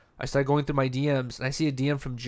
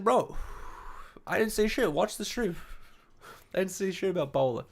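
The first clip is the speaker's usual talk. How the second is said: higher-pitched mock pleading denial; imagined protest; 5 semitones higher, 4 dB quieter than usual. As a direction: act out protesting your innocence.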